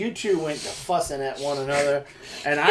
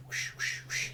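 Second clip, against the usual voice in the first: whooshing noise; 13 dB quieter than usual. Retold or imitated. Imitating truck sliding.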